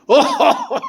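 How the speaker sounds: loud whooping laugh shot way up high; big booming laugh. Retold or imitated. Teacher's booming laugh, loud and high. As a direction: let out a huge room-filling laugh. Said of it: "he had this big booming laugh".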